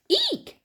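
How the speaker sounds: very high shrill eek; tiny mouse squeak. Acted. Mouse startled by the pouncing cat, high squeak. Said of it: "squeaked me".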